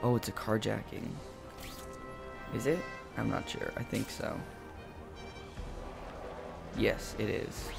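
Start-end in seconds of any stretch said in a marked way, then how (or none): none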